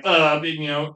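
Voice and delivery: ordinary delivery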